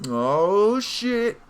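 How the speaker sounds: loud, high burst of real shock. Real, not acted. Player shocked by the murder news, loud and high. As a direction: blurt it out in real surprise.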